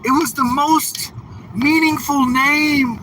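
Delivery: high, whiny mocking voice; mock the guy's earnest plea; lightly whiny impersonation of the viewer; mocking impression. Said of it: "He's like".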